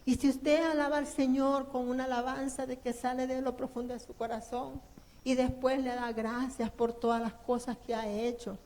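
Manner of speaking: ordinary talk, nothing marked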